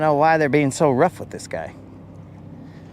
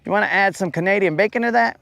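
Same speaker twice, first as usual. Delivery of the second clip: higher, quick mock waiter line; 6 semitones higher, faster than usual. Waiter offering bacon on a pizza, quick and chipper. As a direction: rattle it off like a server.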